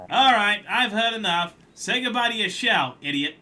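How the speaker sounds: fed-up collector. Acted. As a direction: slam the verdict down.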